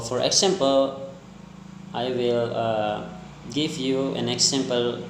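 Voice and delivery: ordinary talk, nothing marked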